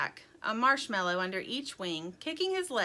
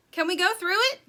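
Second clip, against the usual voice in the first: high, loud shouted question; yelling; 10 semitones higher, 8 dB louder, faster than usual. A narrator's reading. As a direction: call the question over the storm. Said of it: "yelled Sugar".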